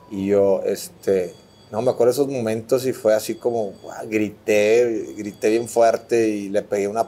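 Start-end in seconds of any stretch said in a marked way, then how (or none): none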